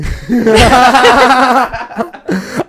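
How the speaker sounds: high, loud burst of laughter; loud cackle. Real, not acted. Host changing subject to Wicked, sudden high cackle. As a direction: burst out laughing after a long pause. Host cracks up after announcement.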